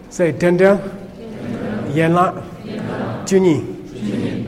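Dendel, Yenla, Junyi said in separate two-syllable pieces; model pronunciation. Shown as a demonstration. Teacher has the class repeat a Tibetan name, modelling pronunciation. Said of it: "just repeat the words".

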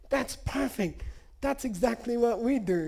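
high-pitched imitated delight; delighted approval. Lama recognizes his own practice in confession, acted delight.

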